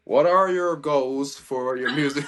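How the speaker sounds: slow, deliberate put-on American accent; British speaker trying out an American accent; American accent attempt. Shown as a demonstration. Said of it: "this next question in American".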